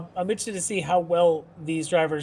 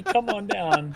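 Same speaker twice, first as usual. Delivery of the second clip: louder, higher come-on-down call; game show host; 5 semitones higher, 4 dB louder, much slower than usual. Retold or imitated. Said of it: "You got a bingo caller saying".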